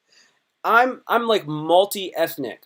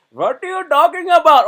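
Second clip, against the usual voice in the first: much higher, loud accented retort; Indian accent demo; 11 semitones higher, 9 dB louder than usual. Shown as a demonstration. Showing how he plays Indian characters, loud high accent. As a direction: snap back in an Indian accent. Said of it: "I can be like".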